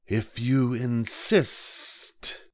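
very slow, drawn-out snake voice; a boa calmly planning lunch, very slow. A narrator's reading. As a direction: stretch every word like a lazy snake. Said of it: "said the snake".

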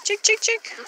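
high sing-song chick chick call; calling chickens. Real, not acted. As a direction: call the hens over for food. Mom calls chickens to feed.

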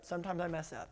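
soft, understated mock confession; offhand admission. Mom shrugs off her mistake to crying child, quiet offhand voice. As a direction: toss it off quietly.

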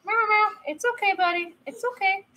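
high, gentle singsong reassurance. Real, not acted. Parent telling upset buddy it's okay, soothing high voice. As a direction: coo to calm a crying little one.

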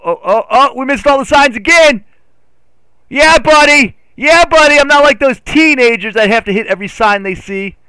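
high, loud excited hollering; triumphant cheer. Real, not acted. Gamer misses all the signs and cheers loud and high. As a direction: whoop in boastful triumph.

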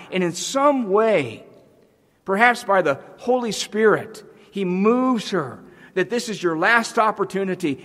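high, loud, swelling preacher's cry; preaching at full force. Acted. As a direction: build the fervour line by line. Preacher builds to a climax.

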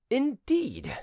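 high, drawn-up note of irony; ironical. A narrator's reading. Prince hearing of the respected fisherman, ironical. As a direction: say it with an ironic smile. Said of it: "with an ironical smile".

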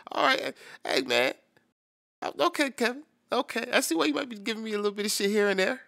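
loud, high and hyped up; grinning as he gives the track its due; excited praise. Real, not acted. Talking to Kevin, admitting the record is good, loud and excited.

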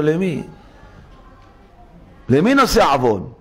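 ordinary talk, nothing marked

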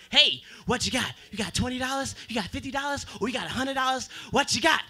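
a hint of a pushy stripper; needy stripper. Stripper pressing the comedian for money, lightly voiced. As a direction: hustle him for cash. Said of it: "These strippers are very needy people".

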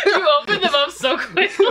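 high, loud, helpless laughing words. Real, not acted. Makeup artist to friend who opened eyes, laughing hard. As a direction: squeal it out while cracking up.

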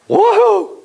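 high joyful woohoo; sudden cheer at the sight of water; excited whoop. Acted. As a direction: whoop like thirsty travelers finding water. Imagined people finding water.

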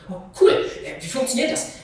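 viel höher und lauter, lachend; überschäumende Freude; lachende Begeisterung. Acted. Shira freut sich über Teleportation. (Shira jubelt lachend über ihre neue Kraft, viel höher)

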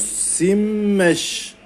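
Simmeş with a long held double m; teacher drawing out the shadda; doubled m demo. Shown as a demonstration. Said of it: "şedde yapıyoruz".

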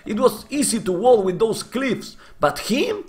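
high-pitched frustrated complaining; angry. Real, not acted. Streamer complaining angrily about walls to chat. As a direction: vent your anger, voice pushed up high. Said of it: "I'm angry, man".

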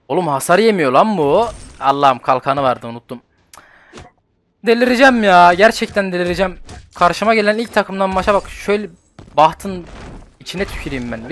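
loud, high exasperated outburst; losing it at the game; frustrated rage. Real, not acted. Gamer losing to the enemy team, furious outburst.

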